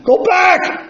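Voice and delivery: high desperate screaming warning; drowning boy's piercing cry of go back; screamed warning. Retold or imitated. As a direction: scream it to stop your friend. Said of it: "the final blood-curdling scream".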